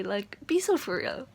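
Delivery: ordinary talk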